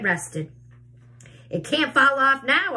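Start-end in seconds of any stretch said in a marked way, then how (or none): 1.5-2.8 loud, higher shout. A narrator's reading. Aunt Sponge shouts about the peach, loud and higher. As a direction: call it out up at the peach. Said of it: "Aunt Spun shouted".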